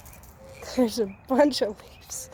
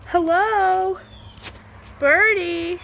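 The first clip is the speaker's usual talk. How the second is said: higher, louder friendly call; 6 semitones higher, 4 dB louder than usual. Real, not acted. Owner calling loudly to a bird in the yard.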